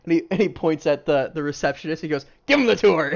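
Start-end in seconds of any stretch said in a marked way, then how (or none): 2.4-3.1 loud, higher dwarf boss voice; shouted order. Acted. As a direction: call the order across to the receptionist. Dwarf orders receptionist to guide.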